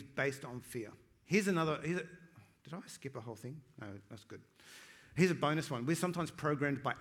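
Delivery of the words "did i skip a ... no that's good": much softer, dropping lower at the end; quiet aside to self. Real, not acted. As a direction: check your notes under your breath. Checking own notes mid-sermon.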